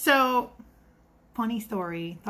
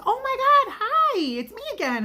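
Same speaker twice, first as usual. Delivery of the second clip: imitating a customer's much higher, louder delighted hello; excited greeting; 5 dB louder than usual. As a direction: squeal it like a happily surprised regular. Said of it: "And she goes".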